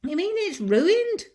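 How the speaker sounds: shocked that the prize is ruined; dismayed. Acted. Mabel's dismay.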